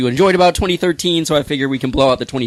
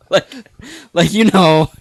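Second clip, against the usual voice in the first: ordinary talk, nothing marked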